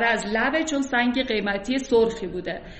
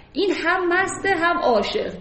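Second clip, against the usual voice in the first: normal talking voice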